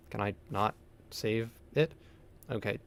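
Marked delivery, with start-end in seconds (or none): none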